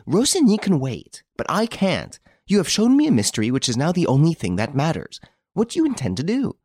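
fast, high, pressing outburst; urgent. Acted. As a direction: rush at him, demanding an answer. She urgently presses Rénine.

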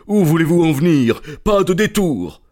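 merchant flares up, loud and high; angry outburst. A narrator's reading. Angry shopkeeper snaps at Holmes, loud and high. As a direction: bursts out at the nosy stranger. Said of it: "une explosion de colère chez le marchand".

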